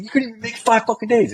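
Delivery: very high, scornful jab; retold angry taunt. Soldier taunting the washout over selection, heated.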